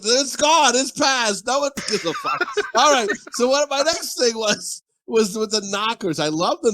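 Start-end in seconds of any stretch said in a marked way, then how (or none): 0.0-2.5 high, laughing brush-off; amused high-pitched dismissal. Real, not acted. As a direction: wave it away high, breaking into laughter. Dismissing question, laughter heard.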